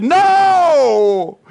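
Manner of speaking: firm loud refusal; strong no. Retold or imitated. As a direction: bark out no. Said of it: "I said it pretty strong".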